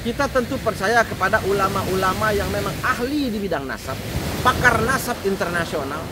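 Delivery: ordinary talk, nothing marked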